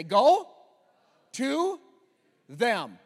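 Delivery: firm loud call to repeat; call and response. Shown as a demonstration. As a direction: lead the crowd line by line. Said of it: "Repeat after me".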